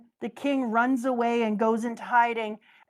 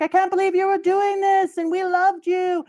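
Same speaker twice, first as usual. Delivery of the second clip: betrayed crowd; 8 semitones higher, 5 dB louder than usual. Retold or imitated. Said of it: "all the people are like".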